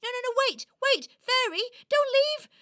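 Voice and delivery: high, loud desperate cry; panicked plea. Acted. Toy begging the fairy not to leave, panicked. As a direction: call out urgently after someone vanishing.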